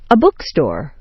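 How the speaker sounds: sharp stress on "book" in "bookstore"; "book" peaks, "store" drops away; first noun stressed. Shown as a demonstration. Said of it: "first one is sharply stressed".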